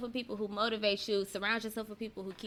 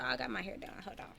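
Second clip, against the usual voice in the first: softly whispered aside; hushed confession about her hair; 2 semitones higher, 8 dB quieter, faster than usual. Real, not acted. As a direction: whisper it like a secret to the viewers.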